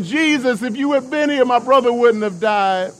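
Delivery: high, desperate pleading to Jesus; crying plea. Retold or imitated. Mary fallen at Jesus's feet, high desperate crying plea. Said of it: "as she's crying".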